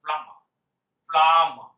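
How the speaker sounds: "প্লামা" said firmly, silent b and no final r; teacher models the right way: "প্লামা" with silent b; correct pronunciation. Shown as a demonstration. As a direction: model "প্লামা" loud and clear, two syllables. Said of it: "উচ্চারণ হচ্ছে".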